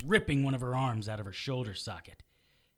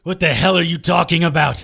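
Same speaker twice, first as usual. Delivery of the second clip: much louder, higher angry demand; loud disbelief; 5 semitones higher, 13 dB louder than usual. Acted. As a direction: bark it at him in disbelief. Hero shouts, confused.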